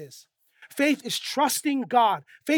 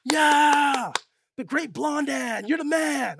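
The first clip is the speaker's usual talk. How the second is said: drawn-out mock crowd cheer; 3 semitones higher, slower than usual. Crowd to Blondin after he walks across, cheering. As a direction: whoop like an admiring fan. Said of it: "they're all cheering him on".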